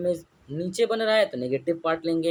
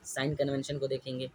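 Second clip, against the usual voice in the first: Sign stressed, answer much softer and lower; quiet answer; 5 semitones lower, 7 dB quieter than usual. Shown as a demonstration. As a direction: drop your voice to answer your own question. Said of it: "क्या देखेंगे?". (धीमी आवाज़ में खुद का जवाब)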